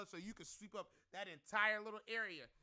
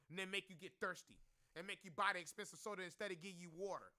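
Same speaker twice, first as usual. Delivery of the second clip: ordinary talk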